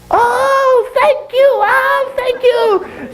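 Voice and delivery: very high squeaky monkey voice; effusive falsetto monkey; grateful monkey. Acted. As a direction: gush thanks in a tiny monkey squeal. Grateful monkey thanks owl.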